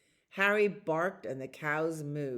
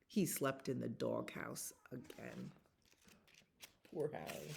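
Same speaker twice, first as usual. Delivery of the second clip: soft, sorry for him; hushed, sorrowful storybook sigh; sympathetic; 4 semitones lower, 11 dB quieter than usual. A narrator's reading. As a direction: pity the poor dog gently. Sad end of chapter.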